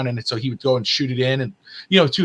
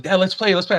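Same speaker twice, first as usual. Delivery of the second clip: lightly voiced pleading kid, higher; imitated eager child; 6 semitones higher than usual. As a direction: beg Dad to play, like a kid. Acting out young son begging to play.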